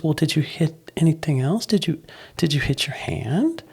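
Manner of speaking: imitating a mother's soft, high questioning; loving concern. Wife asking toddler about his hurts, loving concern. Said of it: "was loving and reaching out".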